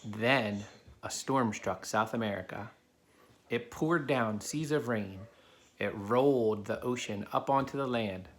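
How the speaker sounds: put-on steady storybook narration for kids; reading a story aloud. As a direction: read it to the class like a picture book. Reads a picture book to children.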